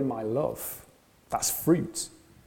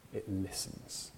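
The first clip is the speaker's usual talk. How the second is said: whispered answer; 5 semitones lower, 13 dB quieter than usual. Acted. Answer to own question.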